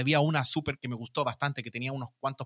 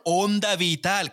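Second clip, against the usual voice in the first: slow, drawn-out Castilian "Onda Vital"; mock a Spain dub, stretching "Onda Vital" slowly; exaggerated Spain Spanish accent; 4 semitones higher, much slower than usual. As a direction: pronunciado con acento de España, lento y exagerado. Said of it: "Con un acento súper español".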